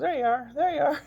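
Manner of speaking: put-on high, loud excited kid's cry; imitating an excited grandson; excited child. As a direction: cry out like a thrilled little boy. Grandson found her in picture.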